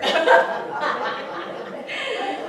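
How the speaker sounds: airy high laughter; breathy laugh. Real, not acted. Laughing at her own joke to the host.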